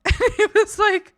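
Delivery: high laughing voice. Real, not acted. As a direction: laugh through the words. Laughing through the telling.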